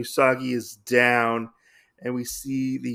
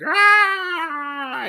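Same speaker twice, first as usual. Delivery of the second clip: sharp falsetto shriek, much higher and louder; demon scream; 6 dB louder than usual. Retold or imitated. The burned demon hand shrieks as the innkeeper strikes, high loud scream. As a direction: let out a pained monster screech. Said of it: "he's like".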